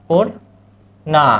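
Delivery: পূর্ণা stretched out very slowly, syllable by syllable; slow teacher demo; drawn-out demo word. Shown as a demonstration. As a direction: spell out পূর্ণা slowly for students. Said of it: "অন্ন".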